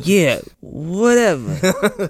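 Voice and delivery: slow slurred mumble; mumbling Godfather voice. Pretend. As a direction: mumble like the Don. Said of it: "I can't understand a word you're saying".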